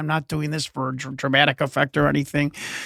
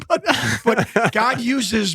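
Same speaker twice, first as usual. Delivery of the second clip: laughing; 4 semitones higher, much slower than usual. Real, not acted. Laughing at own claim.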